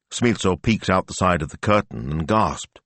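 plain reading voice

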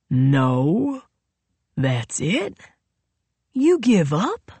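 taunting, each question swooping upward; mocking questions. A narrator's reading. Royal asks if Nola gives up, mocking questions.

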